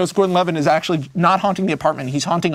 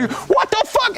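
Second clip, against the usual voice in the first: mock outraged yelp; slower than usual. Man whose umbrella just got knocked away, mock outraged yelp. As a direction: yelp it in acted disbelief.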